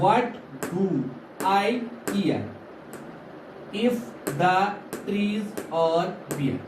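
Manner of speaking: "what, do, I, care" as evenly spaced beats; slow word-by-word beat with pauses, down to "bare"; beating out rhythm. Shown as a demonstration. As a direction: tap out "if the trees are bare" syllable by syllable. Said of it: "जैसे हम beat करते हैं".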